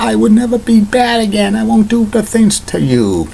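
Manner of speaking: put-on high, pleading little-boy wail, pitch well up; mock crying child. Retelling the movie, the boy cries his promise in mock sobs. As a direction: whimper it out like a boy begging after a scolding. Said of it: "Junior's crying".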